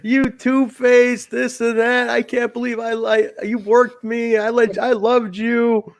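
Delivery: high, drawn-out tirade of betrayal; hammed-up hurt and outrage; imitated pissed-off rant. Bravo raging at the one who worked him, high mock-angry rant. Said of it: "He was all pissed off".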